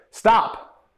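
put-on a parent stopping quarreling kids; firm parent stop. Shown as a demonstration. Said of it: "You say".

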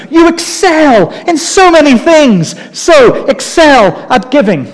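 high, drawn-out mock flattery; hammed-up flatterer, pitched right up; gushing compliment. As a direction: lay the praise on thick and slow. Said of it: "He compliments them".

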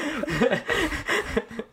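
a short real laugh. Real, not acted. Guest laughing back at the host's joke, short real laugh. Laughs along after the tease.

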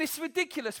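ordinary talk

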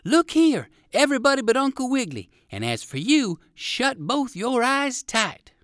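high-pitched, loud call for attention; crying out loudly. A narrator's reading. Poodle doggy cries out to everyone while fleeing the fox, loudly. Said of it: "cried out quite loudly".

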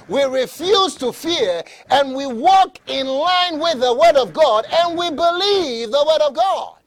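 loud, rising declaration of faith; full-force preaching. Real, not acted.

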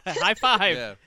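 loud, high cheer; gleeful high five; excited. Real, not acted. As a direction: call it out with a laugh. Celebrating shared home state.

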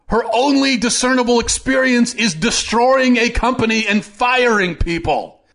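high-pitched, incredulous tirade; indignant outburst, voice pitched well up; exasperated rant. Real, not acted. Tirade against backers of the candidate.

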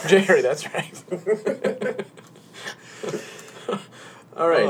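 giggling through it; barely holding it together; laughing. Real, not acted. Giggling after name slip.

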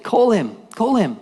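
high-pitched calling out; imitated summons. Jesus stopping to summon Bart, calling out.

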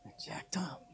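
breathy whispered I jacked up; unvoiced shameful whisper. Shown as a demonstration. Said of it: "But say it like that".

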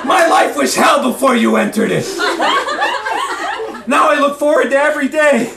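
high, fervent declaration of love; hammed-up romantic outburst, building in intensity; impassioned plea. A narrator's reading. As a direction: shake them out of despair with passion. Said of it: "grabs the pianist by the shoulders".

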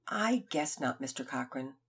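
resigned sigh. Acted. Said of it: "Finn sighed a big sigh".

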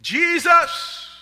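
high, full-voiced scream of the name; yell. Man pulled over calling on Jesus, full yell. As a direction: call out with everything you have. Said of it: "and I just yelled".

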